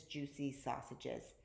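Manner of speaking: usual speaking voice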